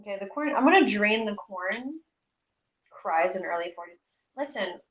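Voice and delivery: usual speaking voice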